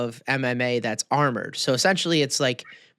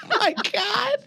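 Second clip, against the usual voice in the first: laughing oh my god. Real, not acted. Laughing at own slip.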